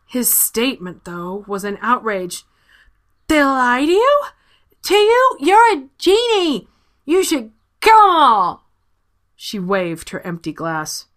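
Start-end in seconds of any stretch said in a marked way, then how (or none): 3.3-8.6 loud, high outraged outburst. Acted. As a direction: shout it out in drunken outrage. Drunk Alma outraged.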